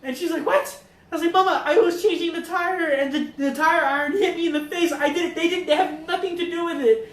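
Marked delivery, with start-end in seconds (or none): 0.4-0.8 very high mock yelp of disbelief; incredulous Mexican mom. Mom hears son's confession, incredulous shriek. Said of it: "that's how I impersonate her". 1.3-7.1 high, raised, imitated panicky son; pleading explanation. Son clears the kids to mom, urgent pleading.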